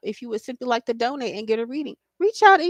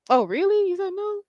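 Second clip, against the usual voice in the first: a quick, higher incredulous echo; surprised reply; 9 semitones higher than usual. Pretend. Woman hears her partner turned someone down, surprised. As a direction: pitch jumps up in disbelief, "Oh, really? You said no?". Imagined woman reacts to confession.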